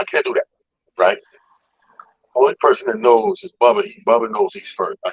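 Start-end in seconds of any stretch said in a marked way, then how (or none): none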